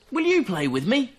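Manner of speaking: high, loud call across the river. Acted. Little fox calling to Otter in the river, high and loud. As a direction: call out to a friend far off. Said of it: "called little Fox".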